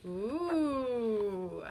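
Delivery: ordinary delivery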